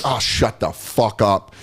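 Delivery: a slow, gruff Patrice brush-off; slow gruff dismissal. Retold or imitated. Patrice dismissing the young comic, slow and gruff. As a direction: drag it out like he's sick of you. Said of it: "he'd be like".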